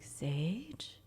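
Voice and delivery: hushed questioning call; soft, tentative check on a friend; cautious whisper. Retold or imitated. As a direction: whisper the name warily. Cautiously calling unresponsive friend.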